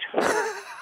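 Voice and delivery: a sudden squeaky laugh at the memory; high burst of laughter. Real, not acted. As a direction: laugh out high at your own story. Laughing at being locked in.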